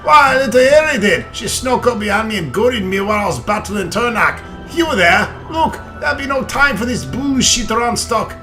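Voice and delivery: dwarf voice. Acted. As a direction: boom it out like an old dwarf. Reads dwarf lines in character.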